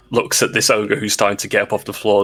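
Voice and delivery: ordinary talk, nothing marked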